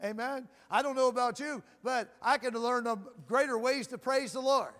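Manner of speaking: usual speaking voice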